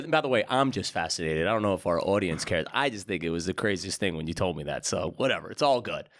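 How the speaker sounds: usual speaking voice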